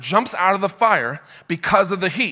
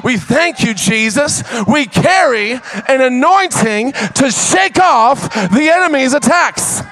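full-force preaching; 6 semitones higher, 3 dB louder than usual. Acted. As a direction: preach it out to the whole church.

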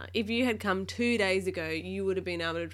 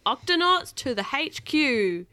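loud, higher captain's command; bellowed order; 9 semitones higher, 8 dB louder than usual. A narrator's reading. Captain ordering the crew to headquarters, bellowed command. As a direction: sound the alarm to the whole crew. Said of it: "bellowed Barnacle".

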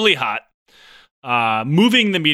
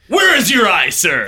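sharp question; 5 semitones higher, 4 dB louder than usual. Retold or imitated. Hitler asks sharply.

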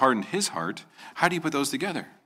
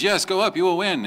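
high, mock-eager promise, lightly played; a prophet's hammed-up yes, tongue in cheek; sarcastic; 8 semitones higher, 3 dB louder than usual. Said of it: "I guess he said it sarcastically".

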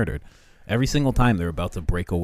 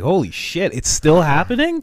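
much higher, louder disbelieving cry; shocked imagined MLK; 10 semitones higher, 8 dB louder than usual. Retold or imitated. Imagined MLK learns it's still happening, shocked. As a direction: squeal it in shock.

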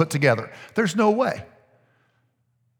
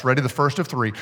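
normal talking voice

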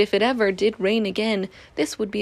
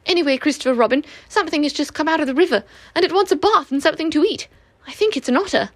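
higher, fast breathless report; flustered rush; 5 semitones higher, 4 dB louder, faster than usual. A narrator's reading. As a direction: hurry it out in a fluster. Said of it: "beginning to panic".

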